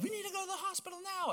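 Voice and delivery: panicked mom. Retold or imitated. Child retelling his mother's panic over the broken arm, frantic high voice. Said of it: "my mom just going into a panic".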